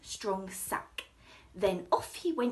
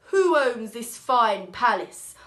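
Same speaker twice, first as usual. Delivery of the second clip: grand king; 12 dB louder than usual. Acted. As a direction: boom it out like a king.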